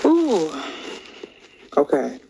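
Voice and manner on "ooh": high, sudden ooh of surprise; a quick startled ooh; surprised ooh. Real, not acted. Greeting viewers with a high surprised ooh. Reacting as the live stream starts.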